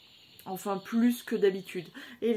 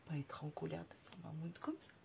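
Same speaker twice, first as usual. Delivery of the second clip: soft unvoiced whisper; 5 semitones lower, 15 dB quieter, faster than usual. Real, not acted. Owner whispering to her pet, hushed. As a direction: breathe it to the pet.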